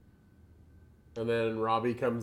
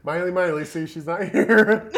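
louder and higher, breaking into laughter; laughing mock cover-up; 7 semitones higher, 9 dB louder than usual. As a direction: fake a cover story while cracking up. Acting out Hannah's clumsy cover.

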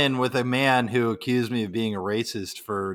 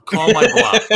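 much higher, louder laughing dare; emphatic dare; 9 semitones higher, 8 dB louder than usual. Retold or imitated. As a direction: push the dare hard, half laughing. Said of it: "I'm like".

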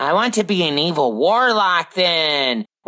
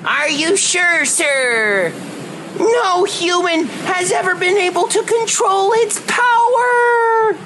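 high alarmed cry, pitched up; alarmed shout; 9 semitones higher than usual. Acted. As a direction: gasp it out in alarm. Pirate game, startled crew.

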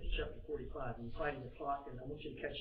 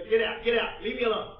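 loud, higher-pitched repeated order; urgent dismissal; 6 semitones higher, 10 dB louder than usual. Acted. Joseph sending the Egyptians away, loud and urgent. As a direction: drive everyone out of the room.

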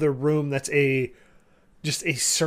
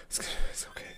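frightened murmur; 10 dB quieter, much faster than usual. Acted. GM voices rocking frightened man.

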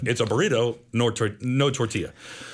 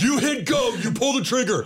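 high, forceful mobster reassurance; full Scorsese; 10 semitones higher than usual. Retold or imitated. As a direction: bellow orders like a tough Italian protector. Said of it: "he goes full Scorsese".

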